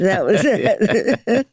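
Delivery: words squeezed out through a laugh; slow and laughing as the joke lands. Real, not acted. Friend laughing at the dying man's ball game line, laughing.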